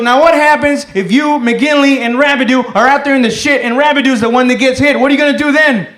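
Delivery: loud, high interrogation; angry sergeant. Retold or imitated. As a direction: grill the soldier like a fed-up sergeant. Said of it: "he got really angry".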